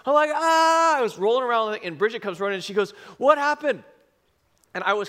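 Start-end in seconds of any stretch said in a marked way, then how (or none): 0.3-1.0 sharp, high, loud cry of pain; retold pained yell. Slams head while burned. 3.2-3.8 high, louder alarmed wife's call. Retold or imitated. Wife comes running, alarmed.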